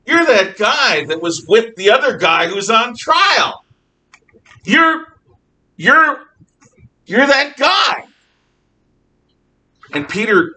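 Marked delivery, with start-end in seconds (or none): 0.1-8.1 loud, high-pitched recognition; halting, insistent pointing-out of a suspect; acted accusation. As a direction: call him out before everyone. Woman recognizes and accuses Peter.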